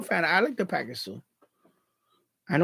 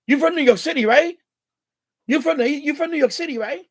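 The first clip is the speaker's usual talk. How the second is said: higher, louder imitation of an excited stranger; eager recognition; 8 semitones higher, 4 dB louder than usual. Stranger at McDonald's hears him order, eager recognition. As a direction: play someone thrilled to spot a familiar accent.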